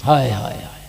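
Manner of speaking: low lament. Acted. Lamenting exclamation.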